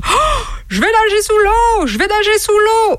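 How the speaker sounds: high-pitched exclaimed outburst; sudden cry. Acted. Camel in midstream announcing a dive, crying out high. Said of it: "le chameau cria soudain".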